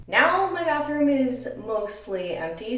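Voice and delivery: echoing in an empty bathroom; big hall echo. Real, not acted. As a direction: speak in an echoey tiled room. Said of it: "Sorry about the echoing".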